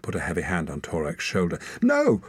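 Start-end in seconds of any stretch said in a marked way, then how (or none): 1.8-2.2 very high, loud shout; desperate cry. A narrator's reading. Torak crying out as he is seized, loud. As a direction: cry out against it. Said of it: "cried Torak".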